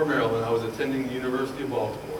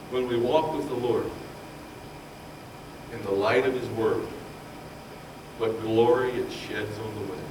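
low, calm recital of hymn verses; hymn recitation; 4 semitones lower, 5 dB quieter than usual. Acted. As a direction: recite the hymn lines gently.

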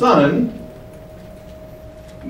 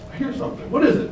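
hushed, soft puzzled bystander; acting out bewildered listeners under the breath; puzzled onlookers; 9 semitones higher, 6 dB quieter, much faster than usual. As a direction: whisper it, then lift into a question. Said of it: "everyone looks, looks, says".

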